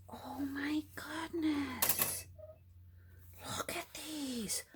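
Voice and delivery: high, slow, whispery awe; hushed amazement. Real, not acted. Crafter shows viewers a treasure she found, hushed amazement. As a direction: draw it out softly in wonder.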